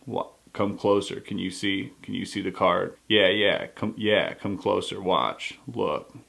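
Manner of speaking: low, hushed street magician luring you in; magician impression. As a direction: draw them closer with a low, intimate voice. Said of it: "Most impersonations of magicians".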